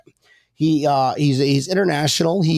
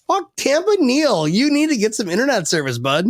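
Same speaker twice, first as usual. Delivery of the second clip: higher, fast mock complaint; mock viewer rant; 7 semitones higher than usual. Host voicing viewers scolding Tampa Neil, mock heckle. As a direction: play an annoyed fan heckling. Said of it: "gonna be like".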